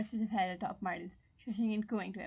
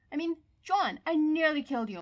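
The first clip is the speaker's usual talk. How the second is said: distraught confession; 6 semitones higher, 5 dB louder than usual. Acted.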